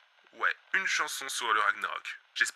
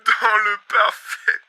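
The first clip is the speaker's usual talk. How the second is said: slow, savouring each word; dreamy reverie; 3 semitones higher, 6 dB louder than usual. Acted. Host dreaming aloud of blissful boredom. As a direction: linger lovingly over the words.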